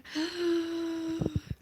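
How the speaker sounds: loud high hammed-up gasp; mock gasp. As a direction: gasp as if the scale shocked you. Shocked at the scale number.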